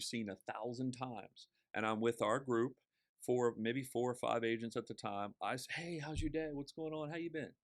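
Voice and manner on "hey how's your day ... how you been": cheery greeting to waiter. Retold or imitated.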